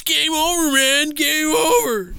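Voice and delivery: high, loud panicked soldier wail; Aliens movie quote. Retold or imitated. Quoting the Aliens marine to a friend, high panicked wail. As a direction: wail it like a doomed space marine. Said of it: "the typical funny jokes that you would see in Aliens".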